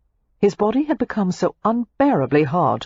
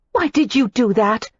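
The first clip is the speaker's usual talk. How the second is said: Daisy demanding; 6 semitones higher than usual. A narrator's reading. Daisy rounds on Matthew, demanding. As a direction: confront him sharply as Daisy. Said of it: "Daisy demanded".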